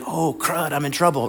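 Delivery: mock-worried little aside; waves in trouble. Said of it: "the waves would be like".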